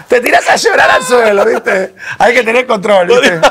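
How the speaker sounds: high, loud hammed-up Argentine wail with laughs; mock Argentine weeping. Joking that Argentines throw themselves to the floor crying, hammed-up wail. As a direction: ham up tearful Argentine drama. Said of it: "Este acento te trae depresión".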